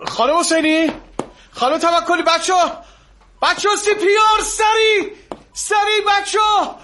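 frantic call for the crash team; urgent shout. Acted. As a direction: yell down the hall for help with CPR. Calling staff for CPR.

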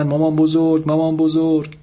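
high mock shout of grandchildren; children calling out. A narrator's reading. Son relays grandchildren shouting for grandma, high-pitched mild shout. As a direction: call out high, the same eager way each time.

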